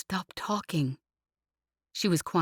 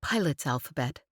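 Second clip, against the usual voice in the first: usual speaking voice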